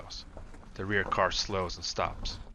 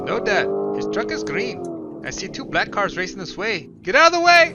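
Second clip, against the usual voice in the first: rising to a full yell, much higher and louder; shouted warning; 10 semitones higher, 9 dB louder than usual. A narrator's reading. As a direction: alarm building to a scream at the cars. Son sees cars racing.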